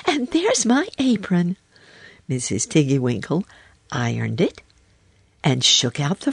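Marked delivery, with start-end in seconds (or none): 0.1-1.6 put-on high, excited little girl; crying out. A narrator's reading. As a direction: exclaim it high, spotting your own things. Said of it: "cried Lucy".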